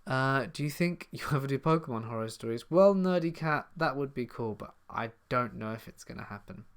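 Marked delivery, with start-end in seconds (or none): none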